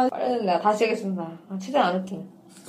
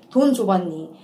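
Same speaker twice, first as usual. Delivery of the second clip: ordinary talk, nothing marked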